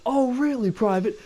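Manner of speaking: high, slow, sneering sergeant voice; mocking drill sergeant. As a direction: taunt the recruit like a drill sergeant. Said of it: "He goes".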